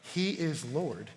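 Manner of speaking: low, soft, measured declaration; solemn preaching. Acted. Preacher declaring Jesus Lord of all, solemn preaching. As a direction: state it gravely, with weighty pauses.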